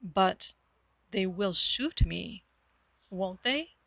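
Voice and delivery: slow, trembling, frightened question; shaky voice. Acted. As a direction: let the voice shake with fear. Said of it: "saying shakily".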